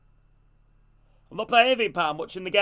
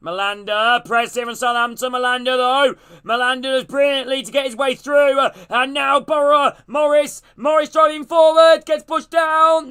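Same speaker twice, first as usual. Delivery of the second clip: much higher and louder, near shouting; excited play-by-play; 7 semitones higher, 6 dB louder than usual. Acted. Calling a break for viewers, building to a shout. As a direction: ride the attack, yelling the names.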